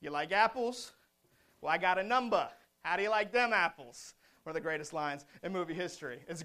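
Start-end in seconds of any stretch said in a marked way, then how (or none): 0.0-2.5 put-on loud movie-quote Boston accent; punchy Boston accent impression. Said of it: "in his great Boston accent". 2.8-4.1 put-on punchy Boston accent movie quote; Boston accent, landed with relish. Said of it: "in his great Boston accent".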